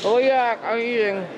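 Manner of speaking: ordinary talk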